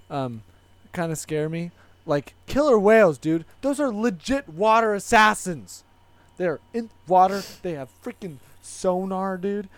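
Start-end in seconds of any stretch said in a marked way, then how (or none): 2.1-9.7 loud, higher excited rant. Real, not acted. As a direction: talk it up with excitement.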